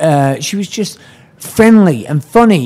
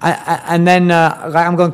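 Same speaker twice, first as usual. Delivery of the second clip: normal talking voice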